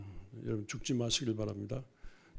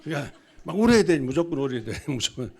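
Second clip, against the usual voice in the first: laughing insistence; 9 dB louder than usual. Real, not acted. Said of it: "insists laughing".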